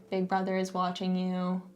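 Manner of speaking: usual speaking voice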